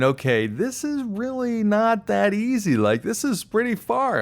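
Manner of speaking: high, strained voice of mounting worry; dawning worry. Retold or imitated. Swimmer realizing the distance is too far, worried. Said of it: "Then I start realizing".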